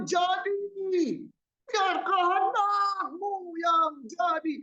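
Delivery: a preacher mocking a theatrical wailing prayer, high and slow; overdone pleading prayer voice. Shown as a demonstration. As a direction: ham up a whiny, high prayer to the Father. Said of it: "saya contohkan".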